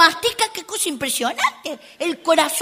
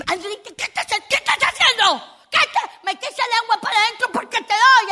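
high, sharp mock scolding mother; 9 semitones higher than usual. Mother scolding her daughter for sticking out her tongue, high mock scold. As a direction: play the mom telling her girl off.

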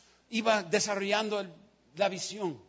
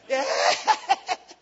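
mocking laugh; 3 dB louder than usual. Someone at summit laughs.

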